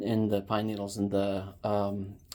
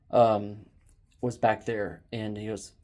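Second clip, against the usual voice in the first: ordinary talk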